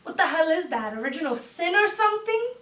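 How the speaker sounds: normal talking voice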